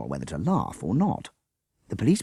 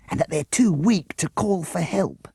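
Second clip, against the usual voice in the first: much higher, lightly voiced child; worried girl; 12 semitones higher, 4 dB louder than usual. A narrator's reading. Jessica to the other children, worried. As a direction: fret in a small child's voice.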